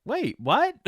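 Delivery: high-pitched double take; taken aback. Retold or imitated. Host reacting to the publicist's movie news, taken aback. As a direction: squeak out your disbelief, acting it out. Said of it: "it t-, took me back for a minute".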